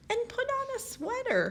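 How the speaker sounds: high fussing mother voice; mother's voice. Acted. Mother reminds flying daughter, high fussy voice. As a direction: chirp it up high like a fussing mom. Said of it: "her mother would add".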